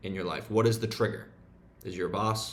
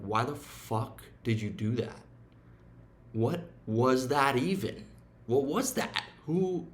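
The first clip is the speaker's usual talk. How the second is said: rising higher and higher in disbelief; incredulous inner voice; 3 semitones higher than usual. Retold or imitated. Scolding himself for snoozing, mock incredulous rise. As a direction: climb up in pitch, baffled at yourself. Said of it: "I'm like".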